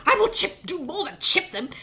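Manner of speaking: high-pitched, heated threat; angry threat. Acted. Wife threatening her husband's horns, angry threat. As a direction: snap back with a worse threat.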